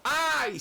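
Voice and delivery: high, whining sigh of complaint. Retold or imitated. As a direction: moan it like a reluctant servant. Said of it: "Y él le dijo".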